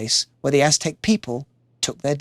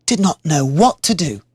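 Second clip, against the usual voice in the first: usual speaking voice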